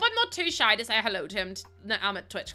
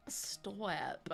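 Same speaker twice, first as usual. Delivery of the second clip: bashful stop; 3 semitones lower, 14 dB quieter than usual. Retold or imitated. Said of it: "And I'm like".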